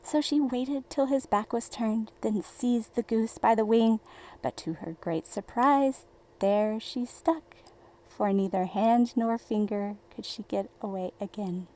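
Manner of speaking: ordinary delivery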